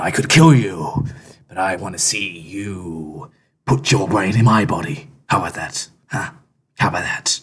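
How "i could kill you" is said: understated anger, rising to a louder threat; angry. Acted. Rational host threatens the impostor, anger breaking through. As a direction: start contained, then push the threat out. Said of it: "I'm angry right now".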